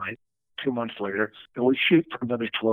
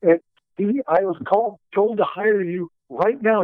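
higher, thrilled retold voice; excited producer; 7 semitones higher than usual. Producer calling Phil at the airport, higher and thrilled. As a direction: pitch up like you can't contain the news.

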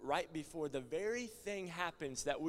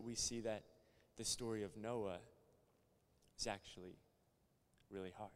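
soft sermon close; 7 semitones lower, 6 dB quieter than usual. Acted. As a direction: draw them in gently. Soft closing preaching.

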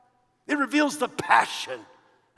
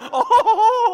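burst of laughter; 12 semitones higher, 9 dB louder than usual. Real, not acted. Preacher laughs at own joke.

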